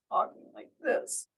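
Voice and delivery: unvoiced whisper; hushed tone demo. Shown as a demonstration. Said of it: "completely different tone".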